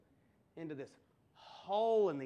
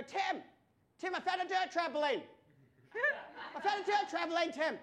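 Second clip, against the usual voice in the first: high excited yell across the farm; calling out; 10 semitones higher, 5 dB louder than usual. Retold or imitated. Kid yelling to his brother about the dirt trampoline, excited shout. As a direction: shout for Tim, thrilled.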